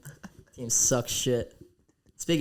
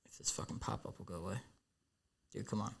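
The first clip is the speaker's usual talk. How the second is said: muttering; 3 semitones lower, 15 dB quieter, faster than usual. Real, not acted. Annoyed at a pop-up on screen.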